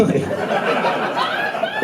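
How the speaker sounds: breathless high-pitched giggle; a squeaky whispered laugh. Real, not acted. Laughs at own joke.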